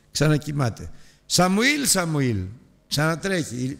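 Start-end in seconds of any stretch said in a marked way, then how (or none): 1.2-2.4 calling out. Acted. God calling again.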